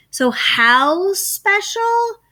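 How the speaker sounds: high, slow "how special?" with a rising teacher tone; slow, high quizzing teacher question; drawn-out question. Shown as a demonstration.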